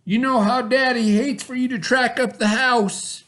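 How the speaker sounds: high, loud barking order; bossy stepbrother. A narrator's reading. Stepbrother ordering Bubba about, loud and high. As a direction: boss him around from horseback. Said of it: "added Milton".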